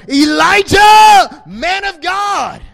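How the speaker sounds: slow, high, loud call; impassioned call. Acted. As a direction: summon the prophet. Calling out Elijah.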